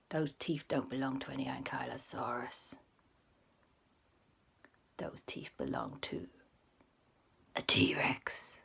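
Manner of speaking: low, unvoiced, slow whisper; spooky T-Rex whisper; ominous whisper. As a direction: reveal the T-Rex in a dread-filled hush.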